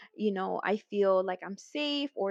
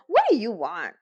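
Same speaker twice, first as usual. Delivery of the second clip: louder, curt annoyed demand; irritated louder phone demand; 8 dB louder than usual. Retold or imitated. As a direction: snap it at the caller. Retold cold answer on phone.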